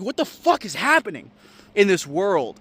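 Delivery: ordinary talk, nothing marked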